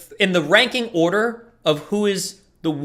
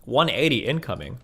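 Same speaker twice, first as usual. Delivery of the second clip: ordinary delivery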